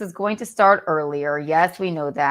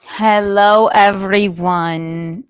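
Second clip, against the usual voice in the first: diva greeting; 10 dB louder, much slower than usual. Acted. Diva host opening the show.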